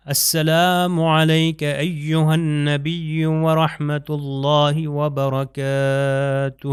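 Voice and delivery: ورحمۃ with clear ح, وبرکاتہ drawn out very slowly; slow Arabic salutation recitation. Shown as a demonstration. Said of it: "اب یہ دیکھیے ورحمۃ اللہ".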